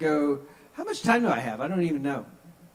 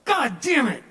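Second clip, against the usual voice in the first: higher, louder comic groan of annoyance; mock exasperation; 10 semitones higher, 6 dB louder than usual. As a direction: sell the fake frustration.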